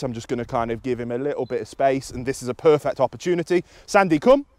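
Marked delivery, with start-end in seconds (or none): 3.9-4.5 high, loud call; recall cue. Real, not acted. Trainer calls the dog in for a rep, recall cue. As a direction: call the dog over, lifting on "come".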